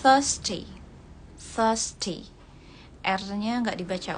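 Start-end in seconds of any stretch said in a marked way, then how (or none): 0.0-2.3 thirsty as "thəsti", no R, British; British thirsty, the R dropped; British accent. Shown as a demonstration. Teacher showing British thirsty with silent R. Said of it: "Untuk British ya".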